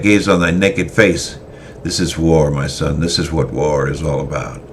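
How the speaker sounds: a callous veteran telling his pupil what war is; grim swordmaster. Acted. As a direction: drive the lesson home with cold conviction. Christoph's grim lecture.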